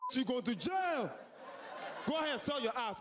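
shouting cop. Acted. Cop arresting a woman on the street, laughing shout. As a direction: yell it like a cop making an arrest.

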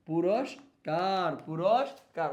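পুরস্কার drawn out syllable by syllable; slow spelling-out. Shown as a demonstration. Teacher dictating to students, slow and clear. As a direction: stretch পুরস্কার slowly so they can write. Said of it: "তাহলে পুরস".

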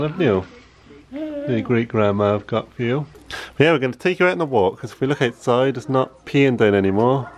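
usual speaking voice

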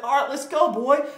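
high, loud taunting challenge; mock taunt at the opponent; trash talk. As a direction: egg him on like a cocky rival. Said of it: "I started talking some smack right back at him".

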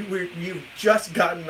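ordinary delivery

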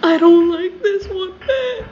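high, drawn-out, near-crying whine; frightened whimper. Real, not acted. Player scared in the game to a friend, frightened whimper. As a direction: wail it slowly and loudly in fear.

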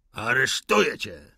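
loud, forceful yell; shouted arrest. A narrator's reading. Officer loses patience with silent prisoner, shouting. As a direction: bellow the order at the prisoner. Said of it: "wrzasnął Wiesnicyn".

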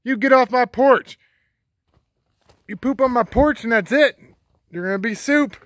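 loud, high scolding yell; shouting at trespasser. Real, not acted. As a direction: yell her off the porch. Scolding chicken on the porch.

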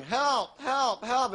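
half-hearted imitation of a swimmer's cry; imitated yelling for help. Comic retelling of a swimmer in trouble, mild mock yell. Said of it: "he's yelling".

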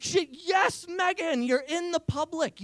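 high excited cheer for Megan. Retold or imitated. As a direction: whoop it up for Megan.

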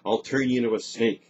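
loud, angry warning; incensed threat. A narrator's reading. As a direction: threaten her, a bit unsure. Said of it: "incensed and a wee bit uncertain".

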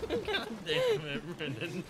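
high laughing exasperation. Real, not acted. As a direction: laugh through the curse. Laughing at teammate.

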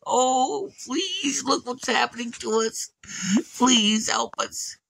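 put-on high, pleading children's wail; mock crying kids. Captive children begging for help, high. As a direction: lightly wail like trapped children.

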